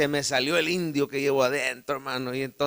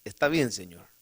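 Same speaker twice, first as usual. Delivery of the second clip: humble reply; 9 semitones lower, 7 dB quieter than usual. Retold or imitated. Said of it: "bien humilde".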